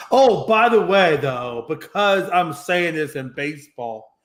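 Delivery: ordinary delivery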